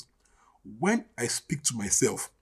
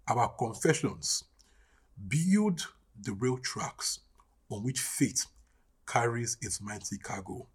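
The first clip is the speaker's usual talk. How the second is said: hushed refrain; 5 semitones lower, 6 dB quieter than usual. Real, not acted. Preacher closing on a repeated confession, hushed and fading. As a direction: let the refrain sink gradually towards a hush.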